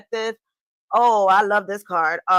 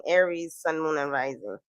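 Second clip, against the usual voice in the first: softer and lower, tossed off; soft repeat; 4 semitones lower, 7 dB quieter than usual. Shown as a demonstration. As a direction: say it again quietly to yourself. Repeats sign call softly.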